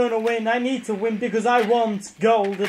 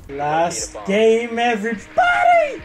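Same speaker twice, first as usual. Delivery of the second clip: rousing announcement; 2 semitones lower than usual. Real, not acted. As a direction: announce it, then holler the last word. Repeats announcement, shouting the end.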